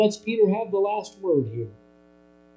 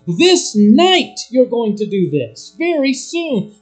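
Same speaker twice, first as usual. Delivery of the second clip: building preaching; 9 semitones higher, 7 dB louder than usual. Acted. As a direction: press the warning home.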